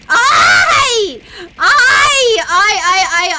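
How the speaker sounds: high, loud repeated oi; loud oi shouts. Real, not acted. Streamer shouting oi at chat, loud and high. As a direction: shout oi at them.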